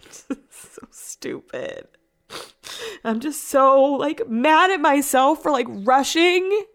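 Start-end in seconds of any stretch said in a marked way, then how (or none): 0.1-1.9 breathy whisper, voice dropped right down; self-scolding whisper. Real, not acted. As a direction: whisper it to yourself in disgust. Berating herself after crashing the car.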